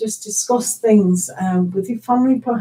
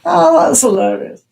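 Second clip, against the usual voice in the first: higher, louder, tickled exclamation; really amused; 7 semitones higher, 6 dB louder than usual. Real, not acted. Reader finds something hilarious mid-session, amused. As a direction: say it just out of a laugh. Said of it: "Sorry, I'll just laugh".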